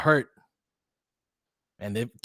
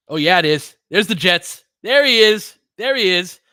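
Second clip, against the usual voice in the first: higher and louder, gleeful shouts of recognition; excited recognition; 7 semitones higher, 10 dB louder than usual. Real, not acted. Watching the game live, excitedly calling out a familiar player. Spotting a returning player on screen.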